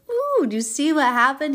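high, loud exclamation; thrilled. Real, not acted. Host excited about the healing. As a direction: burst out with delight.

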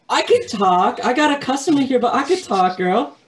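high loud sassy phone voice; sassy receptionist. Retold or imitated. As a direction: gab on the phone, ignoring the customer.

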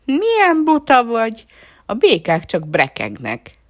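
high, loud mocking frog voice; scornful frog. A narrator's reading. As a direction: scoff at the silly puppy. Frog scolds puppy.

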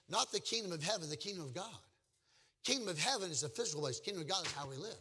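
normal talking voice

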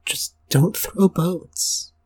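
spoken slowly and deliberately; slow, deliberate advice; slow emphatic tip. Shown as a demonstration. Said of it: "a survival tip".

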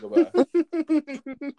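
high, slow disbelieving squeal; mocking the lyric in high-pitched disbelief; incredulous squeak. Real, not acted.

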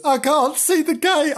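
high, loud kitten wail; panicked kitten. Acted. Kitten unable to find the gate, panicked. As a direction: wail it in alarm.

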